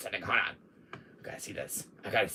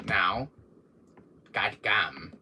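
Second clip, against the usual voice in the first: usual speaking voice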